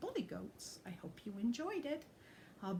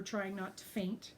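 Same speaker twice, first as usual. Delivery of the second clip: ordinary delivery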